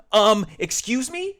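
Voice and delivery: high, loud, slow choked disbelief. Acted. George caught by the cashier, choked indignant squeak. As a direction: squeeze it out, caught off guard. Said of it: "he choked".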